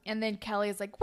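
ordinary talk

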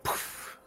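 soft airy "poof"; feather puff sound. Acted. Feathers come off, soft puffed poof. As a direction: puff it out without voice.

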